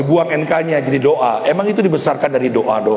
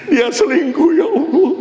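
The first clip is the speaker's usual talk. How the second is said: meratap tinggi kepada Allah; wailing lament; 12 semitones higher than usual. Acted. Betrayed wife's lament prayer. (ratapan istri yang dikhianati, melengking)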